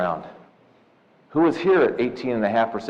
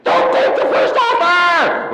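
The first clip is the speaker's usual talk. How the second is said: frantic scream, much higher and louder, slow and laughing; screaming dad; 6 dB louder, much slower than usual. Retold or imitated. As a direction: yell it like a father bolting off the couch. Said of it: "skidding up, screaming".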